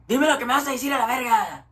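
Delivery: imitating a fast, high-pitched irate caller; heated retold challenge in rapid Spanish; angry demand.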